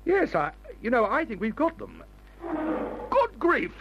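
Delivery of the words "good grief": high-pitched startled exclamation; startled alarm. Acted. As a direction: blurt it out in sudden fright. Startled by a lion's roar.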